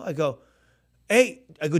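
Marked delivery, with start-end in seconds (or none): 1.1-1.8 raised, higher-pitched hail; calling out. Retold or imitated. Musician hailing the pastor backstage, calling out. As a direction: call out loudly to get his attention.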